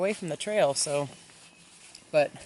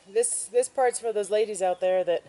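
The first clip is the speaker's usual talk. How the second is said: ordinary talk, nothing marked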